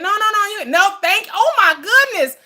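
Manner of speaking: high, loud mock protest of a grateful receiver; mock fake refusal. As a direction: squeal a hammed-up polite refusal. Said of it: "You think I'm saying".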